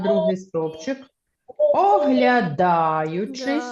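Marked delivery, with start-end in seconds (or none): 1.8-3.6 Оглядаючись read very slowly, syllable by syllable. Shown as a demonstration. Teacher to pupils, first slow reading, very slowly. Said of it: "трішечки прискоримося".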